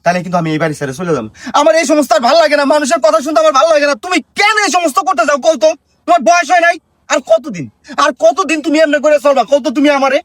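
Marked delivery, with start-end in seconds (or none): 1.6-10.2 high, agitated tirade; pitched-up, worked-up complaint; fed-up scolding. Acted. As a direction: plead and scold in exasperation. Son scolds his mother, fed up.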